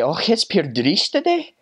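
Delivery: high, slow Scottish brogue; Scottish accent. Acted. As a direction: stretch it out in broad Scottish. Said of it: "on a day like today, they'd say".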